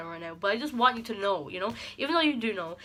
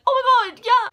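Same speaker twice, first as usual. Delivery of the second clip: much higher and louder, sudden realization; excited squeal; 14 dB louder than usual. Retold or imitated. Crying to her best friend on the phone, excited high squeal. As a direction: shriek it like the penny just dropped.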